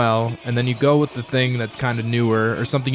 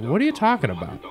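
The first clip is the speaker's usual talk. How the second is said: incredulous; 7 semitones higher than usual. Retold or imitated. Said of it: "And I was like".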